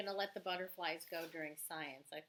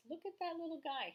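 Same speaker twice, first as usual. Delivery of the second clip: cooing; 10 semitones higher than usual. Real, not acted. Teacher coos at tiny frog.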